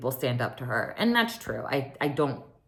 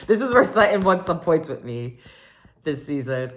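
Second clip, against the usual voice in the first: laughing while she talks, a bit louder; 4 dB louder than usual. Real, not acted. Recapping Sutton's comeback to viewers, laughing. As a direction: chuckle through the words.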